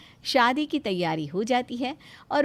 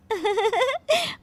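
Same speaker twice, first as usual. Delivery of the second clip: high rising giggle; 9 semitones higher than usual. Acted. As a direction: laugh off the prank. Bride laughs again.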